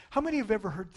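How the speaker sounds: ordinary delivery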